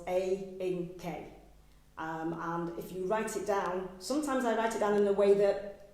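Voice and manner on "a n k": "A, N, K" spoken syllable by syllable with pauses; sounding out words. Shown as a demonstration. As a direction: break "A, N, K" into separate beats. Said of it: "break her name down for people".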